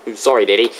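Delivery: normal talking voice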